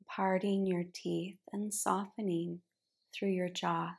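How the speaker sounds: unhurried meditation cueing, letting each phrase settle; guided relaxation. Real, not acted. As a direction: guide them gently into rest, phrase by phrase. Guides relaxation at the start of yin yoga.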